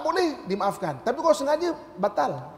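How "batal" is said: normal talking voice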